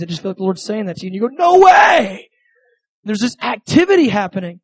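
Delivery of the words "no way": high, loud burst of disbelief; amazed exclamation. Acted. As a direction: cry out as if thrilled by astonishing news. Acted amazed reply to a word.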